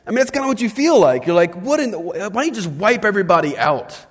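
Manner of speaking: high, loud exasperated cry; exasperated outburst. Retold or imitated. Pastor voicing the congregation's outrage, high and loud. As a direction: throw up your hands, what in the world.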